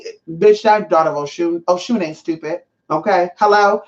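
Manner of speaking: ordinary talk, nothing marked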